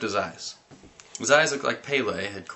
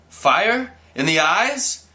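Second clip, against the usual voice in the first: high, loud disbelief; incredulous; 10 semitones higher, 7 dB louder, much slower than usual. Acted. Narrator reacting to burning eyes, shocked. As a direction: ask it in shock.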